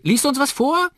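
put-on high, loud little-girl voice; calling twins. Twins ask Liam to read aloud, high calling voice. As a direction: beg loudly as an excited small child. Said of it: "rufen sie".

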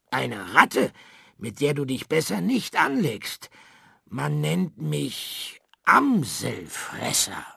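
a tiny worm hamming up a tough rat; growling disguise. A narrator's reading. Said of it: "grollte der Regenwurm". (gespielt grollender Rattenboss)

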